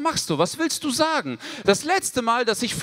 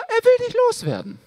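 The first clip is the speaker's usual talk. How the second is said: high exclaimed punchline; 13 semitones higher, 3 dB louder, faster than usual. Acted. Punchline outburst.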